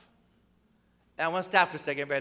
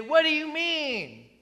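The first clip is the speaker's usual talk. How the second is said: confused disciple; 9 semitones higher than usual. Retold or imitated. Disciples confused, asking Jesus.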